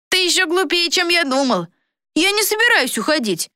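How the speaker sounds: put-on high-pitched sneering boy villain; boy traitor, scornful. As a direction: scoff in a boy's high voice.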